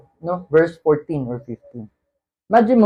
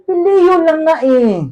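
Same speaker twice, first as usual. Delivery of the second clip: higher, insistent "Phileo lang nga eh"; exasperated insistence; 10 semitones higher, 3 dB louder than usual. Acted. Peter answering Jesus a second time, insistent and higher. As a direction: Peter stubbornly insisting it's only phileo.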